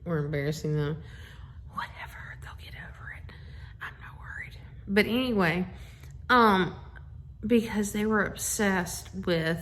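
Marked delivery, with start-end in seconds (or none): 1.7-4.6 unvoiced whispered aside, dropped low. Real, not acted. Mom whispering an aside to viewers about her sons. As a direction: whisper it as a throwaway side remark.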